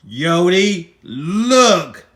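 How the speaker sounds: loud, each word set apart; exasperated call. Acted. Buttercup nagging Yodi to look, loud insistent call.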